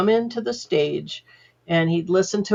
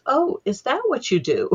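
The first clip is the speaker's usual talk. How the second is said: higher-pitched mock realization, quoting a relative; dawning surprise; 7 semitones higher than usual. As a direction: imitate someone finally getting it. Voicing mother-in-law's sudden realization.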